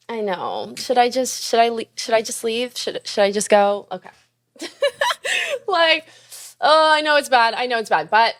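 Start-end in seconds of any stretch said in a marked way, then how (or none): 4.6-5.6 high giggling laugh; embarrassed laugh. Real, not acted. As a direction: laugh it off sheepishly.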